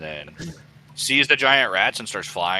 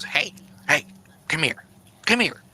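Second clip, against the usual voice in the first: high beckoning goblin; 8 semitones higher, 3 dB louder than usual. Acted. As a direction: call them over like a goblin. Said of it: "And the goblin goes".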